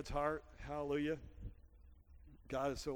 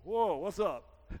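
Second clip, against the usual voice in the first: casual Jesus answer; 3 semitones higher, 8 dB louder, much slower than usual. Retold or imitated. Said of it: "he goes".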